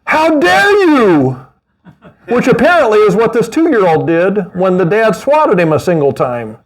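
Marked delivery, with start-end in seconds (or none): 0.0-1.4 high, slow mock-offended kid; indignant child. Pastor mocking a defiant toddler, high and slow. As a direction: sniff it out like an outraged toddler. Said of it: "They were not going".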